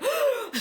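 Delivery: loud, high gasp; mock shocked gasp. Gunso sees the kid before the scooter, mock horrified gasp. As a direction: gasp sharply in pretend horror. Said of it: "Gunso is like".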